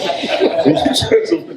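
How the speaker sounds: sharp airy laugh up high; high breathy laugh. Real, not acted. Laughing at his own misjudgment, high airy laugh.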